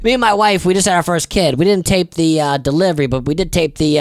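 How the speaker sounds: normal talking voice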